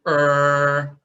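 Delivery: loud open "er" sound; a loud open-mouthed "er". Shown as a demonstration. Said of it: "open your mouth and say".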